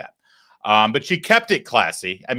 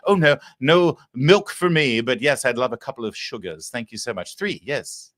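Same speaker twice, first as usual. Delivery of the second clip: prim proper Jasmine Crockett; 2 semitones higher than usual. Retold or imitated. Host mocks Crockett's private voice, prim posh lady. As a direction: parody her as a genteel lady over tea. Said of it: "Jasmine Crockett's like".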